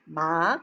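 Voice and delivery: "Ma" rising like a question; questioning upward glide on "Ma"; second tone. Shown as a demonstration. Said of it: "as if you're raising your intonation to ask a question".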